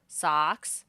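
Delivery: loud, firm order to the pet; firm command. Acted. The hero orders Socks down in front of the crowd, firmly. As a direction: call it out like training a dog. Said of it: "you command".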